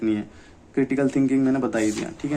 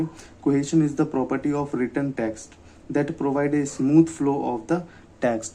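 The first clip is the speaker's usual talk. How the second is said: slow dictation; much slower than usual. Shown as a demonstration. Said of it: "definition read slowly".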